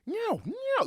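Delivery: high, drawn-out elk cow call. Retold or imitated. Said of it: "I start cow calling as loud as I can".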